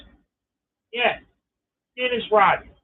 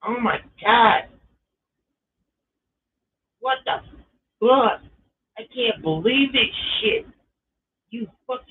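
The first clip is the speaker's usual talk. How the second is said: a jump up in pitch on what the; mock horrified, can't believe the shift; shocked disbelief; 5 semitones higher than usual. Cashier stuck on the register, reliving the shock.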